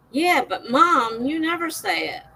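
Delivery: imitating her kids' protest. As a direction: drag it out like a whiny teen. Kids answer in quoted voice.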